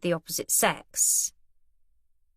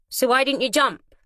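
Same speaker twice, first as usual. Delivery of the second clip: taunting question; 6 semitones higher, 4 dB louder than usual. A narrator's reading. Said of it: "Finn asked".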